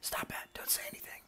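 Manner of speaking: urgent shushing in a whisper; shush, then whisper very softly; hushing whisper. Acted. Hidden creature silencing the other one, hushed whisper. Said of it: "another voice is like".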